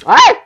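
sharp high cry; startled yelp. Real, not acted. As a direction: yelp in surprise. Yelp while caught in fight.

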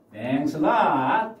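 very slow; slow model phrase. Shown as a demonstration. Teacher modelling thanks slowly for learners. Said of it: "teacher models phrase".

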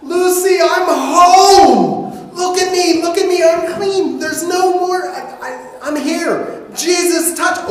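high, loud, jubilant shouting; overjoyed shout. Acted. Healed leper shouts to his wife he's clean, jubilant. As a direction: burst through the door bursting with joy.

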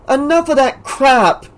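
high, sharp snapped retort; snapping. A narrator's reading. Debbie snapping at Alice. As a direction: cut her off angrily. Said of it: "Debbie snapped".

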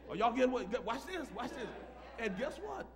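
ordinary talk

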